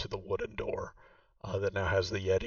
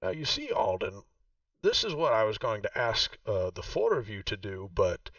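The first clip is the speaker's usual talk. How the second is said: [name]'s voice; 2 semitones higher, 4 dB louder than usual. Acted. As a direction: play the proud host showing her old home. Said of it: "and she says".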